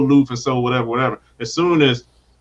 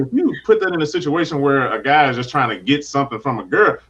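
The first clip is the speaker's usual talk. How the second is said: normal talking voice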